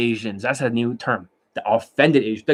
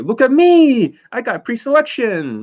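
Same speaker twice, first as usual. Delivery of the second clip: mock show-off; 9 semitones higher, 4 dB louder than usual. Mocking a show-off student.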